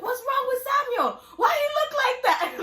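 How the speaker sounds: very high, loud squealing disbelief, laughing; shocked squeal. Retold or imitated. Mimicking her child self at the hospital, squealing in shock.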